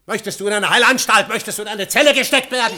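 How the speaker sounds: furious shout. Acted. Husband shouts at his wife about the asylum, furious. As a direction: shout her down.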